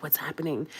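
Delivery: hushed dramatic movie line; a touch of serious movie drama. Retold or imitated. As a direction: drop into a grave film-trailer hush. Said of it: "my voice".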